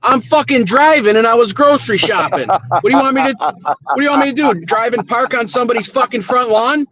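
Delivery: fed up and pushing back hard; exasperated rant. Real, not acted. Scolded about the show, he fires back in real exasperation. Host scolded him for missing guest.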